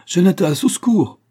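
high, loud call for help; panicked cry. A narrator's reading. Frightened woman calls a servant for help, panicked shout. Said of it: "cria-t-elle".

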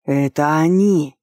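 slow, loud piercing yell; piercing shout. A narrator's reading. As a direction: shout it out, drawn out and shrill. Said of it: "пронзительно закричал маг".

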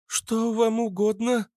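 very high, slow shop-assistant voice; saleswoman's greeting. A narrator's reading. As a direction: offer help in a high, measured voice. (высокий голос услужливой продавщицы)

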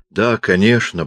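plain reading voice

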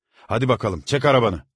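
loud, high shout. A narrator's reading. Servant shouting at the crying boy. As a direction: bark it at the boy. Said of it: "diye çocuğa bağırdı".

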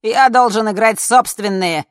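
high, loud insistence; shouted protest. A narrator's reading. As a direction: call it out over the crowd. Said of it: "закричал С".

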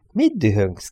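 usual speaking voice

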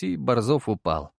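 plain reading voice

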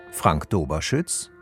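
ordinary delivery

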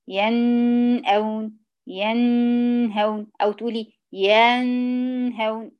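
"ينأون" and "ينهون" with the vowel before the nun drawn out; "ينهون" showing the wrong stretched vowel; lengthened vowel. Shown as a demonstration. Teacher imitating a student's overlong vowel before the nun. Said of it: "زودي في حركة الحرف".

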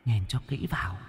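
soft, rising, ghostly insistence; eerie hissing command. Acted. The dead butcher's ghost forcing Thúy to look. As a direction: press her to look, like a vengeful ghost.

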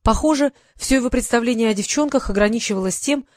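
usual speaking voice

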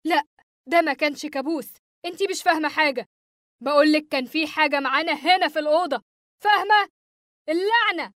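high-pitched, heated outburst; acted rage, rising in pitch; angry shouting. A narrator's reading. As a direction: snap back, building to a curse. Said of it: "صرخت فيها بغضب".

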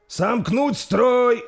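very slow, high, loud order; shouted command. Acted. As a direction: drag the order out across the field. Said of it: "прокричал принц".

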